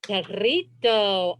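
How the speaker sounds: perrito with a long rolled r, slow. Shown as a demonstration. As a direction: roll the r in perrito, savoring it. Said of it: "I like the way it rolls".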